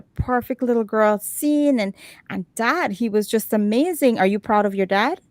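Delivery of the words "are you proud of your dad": ordinary talk, nothing marked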